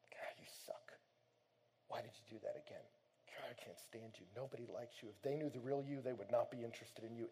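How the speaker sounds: soft, hushed, whispered self-contempt; bitter self-talk. Retold or imitated. Guy berating himself after failing, hushed bitter whisper. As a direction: mutter it like a beaten man.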